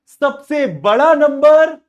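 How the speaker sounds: high, loud, slow emphasis; emphatic. Real, not acted. Teacher stressing question phrase to students. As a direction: drive the key words home.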